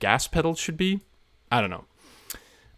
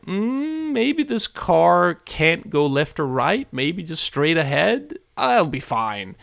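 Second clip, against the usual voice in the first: long high "mm", then slow wishful hedging; doubtful self-talk; 6 semitones higher, slower than usual. Acted. Streamer imitating himself as the day runs out, doubtful hedging. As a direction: waver slowly, then settle for less. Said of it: "it's like".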